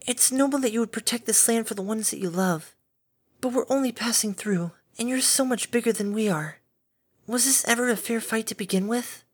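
calm a frightened beast kindly; gentle caretaker Fluttershy. A narrator's reading.

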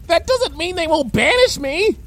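high-pitched alarm; panicked. Acted. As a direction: protest in a panic. Panicked argument over arson.

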